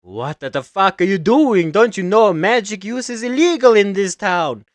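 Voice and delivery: high, loud outraged yell; angry cow. Acted. Talking cow scolds the spellcasters, angry yell. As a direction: blast them for using magic. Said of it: "come alive going".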